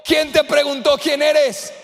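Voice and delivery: voz de Dios y Moisés gritada y lenta; diálogo gritado. Acted. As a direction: proclama a gritos, muy despacio. (gritando lento con fuerza)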